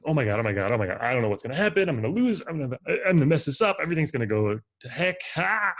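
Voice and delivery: mock panic, fast and breathless; fast anxious self-talk. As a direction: rattle it off like a mind racing in panic. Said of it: "speaking to themselves very, very quickly".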